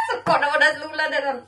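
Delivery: loud, fast, through real laughter; laughing words. Real, not acted. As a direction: blurt it out while cracking up. Laughs while reporting the comment. (nagkatawa samtang nagsulti)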